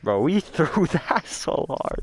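slow, laughing disbelief. Real, not acted. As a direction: laugh through the line. Laughing after losing round.

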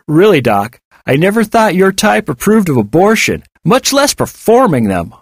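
high-pitched mock astonishment; goading bully. David accuses the doctor, mockingly incredulous. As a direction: jeer with exaggerated swoops of disbelief.

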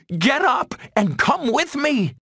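high, pleading fawn shout; crying out. A narrator's reading. Young deer begging fallen friend to flee, high cry. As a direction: call out urgently in a high young voice. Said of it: "cried Bambi".

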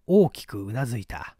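ordinary delivery, nothing marked

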